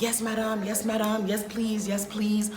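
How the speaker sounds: mock the server sucking up to the table; imitating fawning server. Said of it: "She's literally like".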